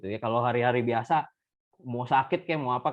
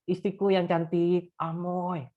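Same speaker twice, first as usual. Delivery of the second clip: a lightly played, feeble old shopkeeper on his deathbed; a frail voice that fades out at the end; barely holding on, calling his wife; weak dying voice; 4 semitones higher, slower than usual. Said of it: "dengan lemah si engkau panggil".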